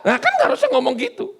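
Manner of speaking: high excited aside. Real, not acted. Preacher's joking aside.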